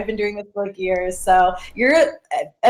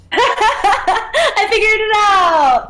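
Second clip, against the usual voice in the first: laughing; 11 semitones higher, much slower than usual. Real, not acted. Guest joins, triumphant laugh.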